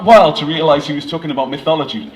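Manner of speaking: ordinary delivery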